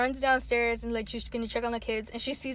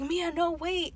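higher-pitched call without raising the volume; retold pleading call; 6 semitones higher, slower than usual. Friend retells Aiden calling after Mia, mock pleading. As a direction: imitate calling after someone to stop.

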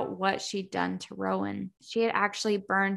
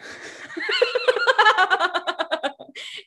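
high, rising burst of laughter; 12 semitones higher, 5 dB louder than usual. Real, not acted. Laughing at getting angry.

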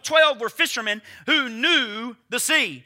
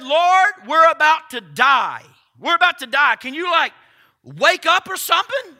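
loud, slowed cry of fear; mock panicked shouting; 3 semitones higher, 5 dB louder than usual. Preacher voicing terrified disciples waking Jesus, loud frantic cry. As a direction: yell like sailors sure they will drown.

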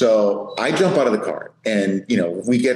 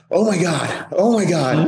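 out of breath; 7 semitones higher than usual. Retold or imitated. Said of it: "still trying to grab his breath".

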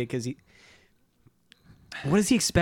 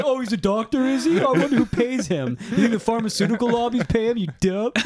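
sarcastic mocking; 8 semitones higher than usual. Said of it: "he's like".

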